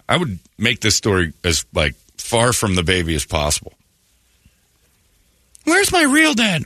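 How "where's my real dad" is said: put-on very high little kid voice; child voice. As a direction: ask like a small child. Kid asking about father.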